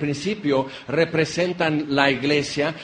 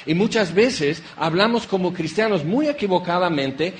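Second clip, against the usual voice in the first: ordinary talk, nothing marked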